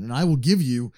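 ordinary delivery

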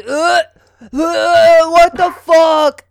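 long gagging groan into a yell; high, very loud mock revulsion; imitating disgusted brother. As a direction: retch loudly, then holler it slowly.

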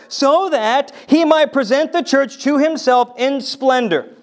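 ringing Bible reading from the pulpit; proclaimed scripture reading. Real, not acted. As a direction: read the passage out with full conviction. Reads scripture aloud forcefully.

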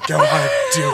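mock her suggestive tone in a high voice; mocking salacious. Imitating his co-host's salacious line in mock tone. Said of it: "the way you said that".

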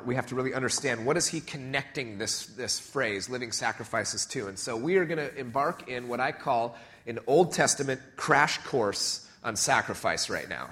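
ordinary talk, nothing marked

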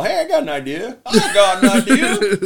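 high, laughing schemer; scheming kid voice. Retold or imitated. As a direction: giggle out a bright idea.

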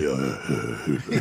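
very low, short grunt from a big man; big buff friend grunting instead of talking; wordless big-guy grunt. Retold or imitated. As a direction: drop way down for one wordless grunt. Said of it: "ain't no words".

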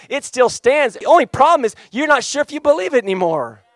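loud, high, impassioned preacher delivery; full-force preaching. Real, not acted. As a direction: preach it out to the whole room.

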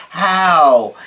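"How" stretched long and falling; dramatic drawn-out how. Retold or imitated. Client's way of saying it, "How" dramatically stretched. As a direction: draw out "How" in mock amazement. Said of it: "that's how he would say it".

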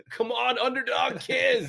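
high, loud cheer for the underdog; root him on from the couch. Retold or imitated. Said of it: "he's got the whole house like".

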